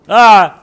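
sudden loud yelp of alarm; shriek. Real, not acted. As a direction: let out a sharp yell. Character dies in game.

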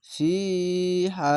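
"ফি", then "হা", each vowel long and sustained; elongated vowels. Shown as a demonstration. Teacher demonstrates one-alif stretches, drawn-out "ফি" and "হা". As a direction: stretch both "ফি" and "হা". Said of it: "জেরের পরে ইয়া সাকিন এক আলিফ টান".

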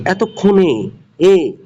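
এতক্ষণে with the closing এ held out and repeated; final vowel isolated. Shown as a demonstration. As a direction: show that এতক্ষণে ends in an open এ. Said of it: "এ উচ্চারণ হয়েছে".

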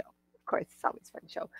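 whispered aside to the viewers. Acted. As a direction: lean in and whisper it like a little secret. Aside about always fun show.

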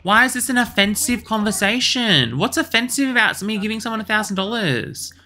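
high, loud indignant question; exasperated. Real, not acted. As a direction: protest in disbelief. Frustrated at game.

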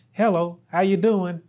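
ordinary talk

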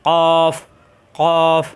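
a slow, firm কফ from the back of the tongue; teacher modelling কফ for pupils; letter sound demo. Shown as a demonstration. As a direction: press the tongue root to the palate and say কফ. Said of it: "কফের মাখরাজ জিহ্বার গোড়া উপরে তালুতে চাপ দিয়ে".

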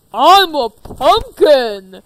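slow, loud monster boom; booming pumpkin voice. Acted. Pumpkin creature announces itself.

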